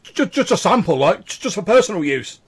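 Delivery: high, loud nervous excuse; sheepish stammering. Acted. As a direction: stutter out a guilty excuse. Scared captive excuses the sample.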